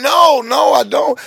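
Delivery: high, hurried-sounding mock panic; retold alarmed refusal. Pastor refuses lap dance, high alarmed imitation. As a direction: refuse from the top of the voice.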